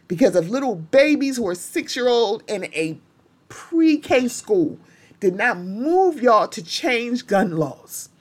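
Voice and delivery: usual speaking voice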